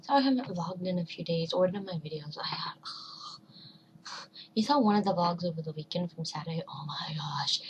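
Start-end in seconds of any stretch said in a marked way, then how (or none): 2.4-7.6 whispering. Real, not acted. Whispered aside about weekend.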